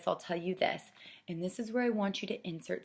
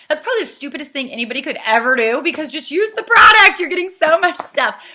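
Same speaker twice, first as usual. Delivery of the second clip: loud, high-pitched incredulous outburst; big over-the-top protest; mock exasperation; 8 semitones higher, 17 dB louder than usual. Shown as a demonstration. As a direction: blurt it out, voice jumping way up. Jokey aside on returning the bag.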